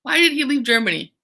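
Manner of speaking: ordinary talk, nothing marked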